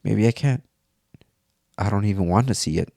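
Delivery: usual speaking voice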